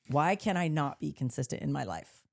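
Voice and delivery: usual speaking voice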